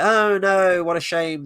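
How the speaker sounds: mock dismay. Viewer on death of unknown elves, sarcastic mock grief. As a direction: pretend to care, hammed-up.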